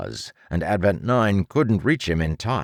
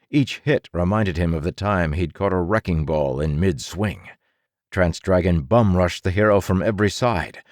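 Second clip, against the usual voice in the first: plain reading voice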